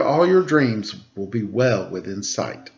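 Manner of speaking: normal talking voice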